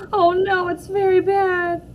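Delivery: high, slow tearful wail; crying. Acted. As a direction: break down over how bad it is. Shame meter rising, May distraught.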